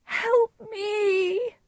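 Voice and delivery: very high, tearful plea; high-pitched crying appeal; Juliet. A narrator's reading. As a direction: wail for help, drawn out. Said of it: "Juliet cried".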